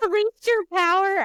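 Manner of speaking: high, loud, amused "Released your power?"; delighted disbelief. Real, not acted. Host reacts to her guest's story with amused surprise. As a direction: echo it back in delighted surprise.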